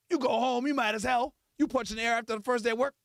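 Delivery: ordinary talk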